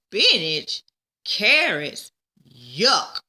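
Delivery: loud, grossed-out protest; disgusted. A narrator's reading. Peggy grossed out by the vegetables Mister Cooper names. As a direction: spit out each food in disgust. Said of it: "Peggy made a face".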